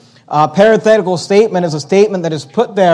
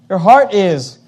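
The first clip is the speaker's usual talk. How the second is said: ordinary delivery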